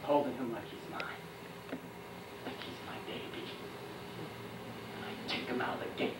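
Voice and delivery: low voice fading toward a whisper; tender, hushed. Acted. Soldier holding the man like his baby, tenderly hushed. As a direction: cradle the words, very low and soft.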